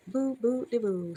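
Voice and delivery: high little squeaky boos; squeak. A narrator's reading. Little ghost sis greets visitors, squeaking. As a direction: squeak it playfully, starting high. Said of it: "squeaked the little sis".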